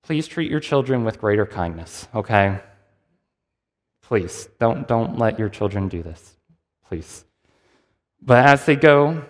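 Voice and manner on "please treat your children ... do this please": softer, lower, pleading; earnest plea. Real, not acted. Preacher pleading with parents in the congregation, soft and earnest. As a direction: gently beg the parents in the pews. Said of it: "if you're a parent".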